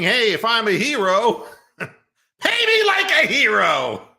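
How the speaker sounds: a giggling, hammed-up impersonation; mock demand. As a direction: play her as laughing while she demands to be paid. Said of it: "Ashley Solis is saying".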